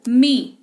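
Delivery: मी with a long ee, cut off short; long ee demo. Shown as a demonstration. Teacher demonstrating the long ee in मी. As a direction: say मी with a long ee, then stop. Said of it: "तुरंत खत्म हो गया".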